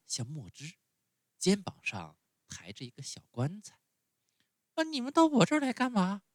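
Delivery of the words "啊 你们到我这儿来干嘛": much higher, louder, fast panicked shout; frightened cry. A narrator's reading. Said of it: "叫道，害怕地".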